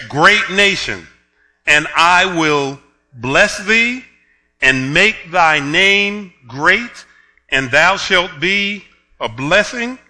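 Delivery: slow, loud, solemn King James reading; measured pulpit reading of the Lord's words; scripture reading. Acted. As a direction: proclaim the verses slowly and gravely. Reads scripture aloud slowly.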